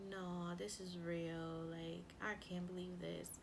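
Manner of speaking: quietly dumbfounded, acted out; retold stunned shock. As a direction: replay being stunned, voice dropping. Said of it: "staring at the phone in shock".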